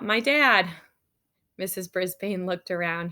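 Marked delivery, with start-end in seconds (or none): 0.0-0.7 put-on loud, higher kid's shout; sudden loud blurt, voiced in narration. A narrator's reading. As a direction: shout it out across the classroom. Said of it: "A voice shouted out".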